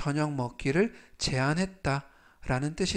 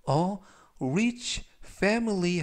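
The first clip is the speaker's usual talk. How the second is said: slow reading; 4 semitones higher, much slower than usual. Shown as a demonstration. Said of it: "활용 문장 보시겠습니다".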